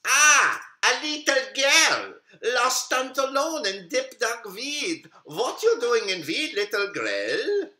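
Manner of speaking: high, sly big bad wolf. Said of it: "The wolf said".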